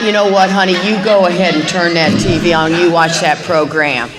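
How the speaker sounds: loud, pointed mock permission; mock magnanimous. Wife telling her husband to watch TV without her, mock magnanimous. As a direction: loudly tell honey to go ahead, playing the martyr. Acted spat over TV show.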